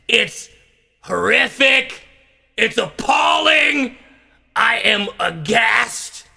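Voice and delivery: slow, high theatrical indignation; hammed-up appalled declamation; mock horror. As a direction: draw each word out, aghast. Mock outrage, repeated for effect.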